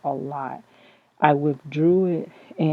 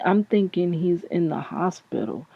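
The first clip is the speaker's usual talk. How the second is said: ordinary talk, nothing marked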